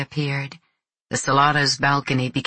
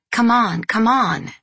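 excited urging, higher-pitched calls; excited shouts; 6 semitones higher, 3 dB louder than usual. A narrator's reading. Revellers urging on the fireworks, excited shouts. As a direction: call out eagerly, pitched up. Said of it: "of shouts like".

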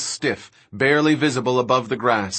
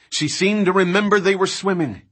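ordinary delivery, nothing marked

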